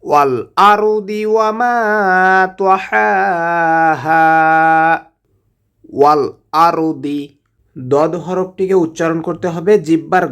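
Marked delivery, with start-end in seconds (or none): 0.0-7.2 Quran recitation. Acted. Teacher reciting the verse for students, slow chant. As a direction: draw out the final vowels melodically.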